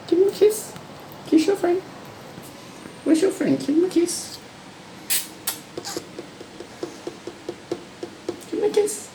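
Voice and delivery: very high squeaky coaxing; high pet talk. Acted. Owner coaxing lorikeet to kiss its friend, very high. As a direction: coo at the bird.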